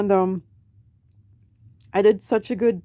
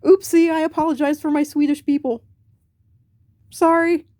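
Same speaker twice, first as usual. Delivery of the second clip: higher, louder playful sorry; cheeky apology to the Vikings, higher and louder; sheepish apology; 7 semitones higher, 4 dB louder than usual. Real, not acted. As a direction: apologize sheepishly and sign off brightly. Apologetic sign-off.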